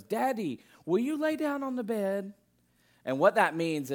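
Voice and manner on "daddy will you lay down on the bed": put-on raised pitch, sweet little boy; small child voice. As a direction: ask like a little kid. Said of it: "he goes".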